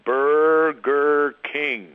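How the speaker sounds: very slow, every syllable stretched; slow drawn-out. Retold or imitated. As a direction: draw out "Burger King" slowly. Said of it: "And say it slow".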